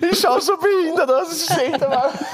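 very high, squeaky with laughter; laughing. Real, not acted. Friend describing own awful passport photo, laughing. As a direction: barely get it out for laughing.

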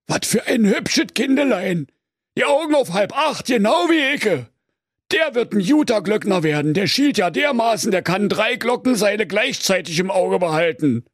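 high, flustered stammering sexton; caught-out Berlin sexton. Acted. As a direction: bluff your way out of the wardrobe. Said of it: "und sprach". (hoher, ertappter, stotternder Küster)

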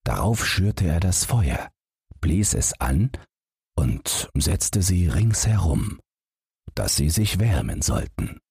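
plain reading voice

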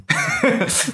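helles Lachen. Real, not acted. Erleichtert nach dem Spiel.

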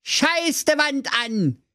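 high, slow delighted exclamation; overjoyed cry. Acted. Brudi overjoyed at the cola machine, high slow cry. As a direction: savour the joy of finding cola. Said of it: "rief der Brudi überglücklich".

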